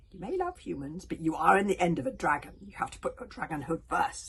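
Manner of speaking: lightly voiced stern dragon; commanding dragon. A narrator's reading. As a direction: insist forcefully on dragon loyalty.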